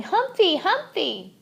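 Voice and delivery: put-on high excited toddler call; shouting. A narrator's reading. Little boy rushing in, shouting the hamster's name. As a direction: call out to the pet.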